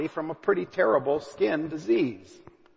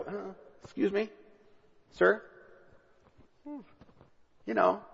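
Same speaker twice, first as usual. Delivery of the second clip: softer, hesitant "Uh, excuse me, sir?" rising like a nervous question; timid servants; 8 dB quieter than usual. Retold or imitated. Servants nervously approach Naaman, quiet and hesitant. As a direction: tiptoe up to an angry master, softly.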